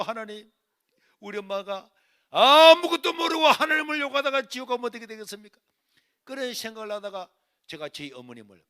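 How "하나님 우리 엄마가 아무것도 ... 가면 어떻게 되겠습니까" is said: pleading, swelling loud and high; urgent inner prayer. Retold or imitated. Son praying for his cursing mother, loud urgent plea. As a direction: cry out anxiously to God. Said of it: "내 속으로".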